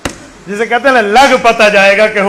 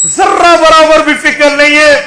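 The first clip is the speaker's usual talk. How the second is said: higher, raised preaching cry; impassioned reproach; 6 semitones higher, 5 dB louder than usual. Real, not acted. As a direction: burst out in reproach at their indifference. Preacher laments the congregation's indifference. (जोश भरी, ऊँची आवाज़ में मलामत)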